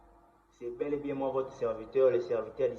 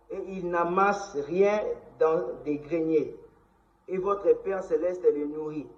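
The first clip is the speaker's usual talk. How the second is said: solemn public proclamation, higher and louder; scripture reading; 5 semitones higher, 7 dB louder than usual. Acted. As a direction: proclaim the verses loudly, phrase by phrase. Continues reading Bible verses aloud.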